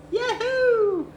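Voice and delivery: high excited whoop; a loud happy yahoo; yahoo cheer. Real, not acted. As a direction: whoop it out with joy. Cheering, feeling good today.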